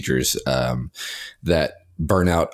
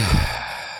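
voiceless, breathed-out sigh. Real, not acted. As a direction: let out an audible sigh.

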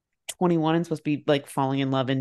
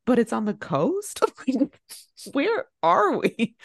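higher-pitched, puzzled protest; baffled mock question; 6 semitones higher, slower than usual. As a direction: act out someone utterly lost on the map. Retells friend's baffled question.

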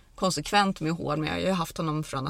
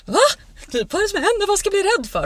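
high, loud, imitated bewilderment; startled horse's voice; 7 dB louder, faster than usual. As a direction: play the baffled horse, pitched way up. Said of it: "det var som att han bara". (spelat förvånad häströst)

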